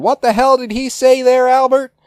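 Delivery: very high, loud shouting voice; Gordon Cole shouting. Retold or imitated. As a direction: bellow every line like a deaf boss. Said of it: "Cole going".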